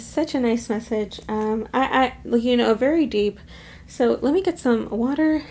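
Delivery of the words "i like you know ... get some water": normal talking voice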